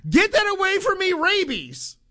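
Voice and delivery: high, panicked mock shriek; retold scream. Host retells shrieking at coworker's dead bat, panicked and high. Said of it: "And I screamed".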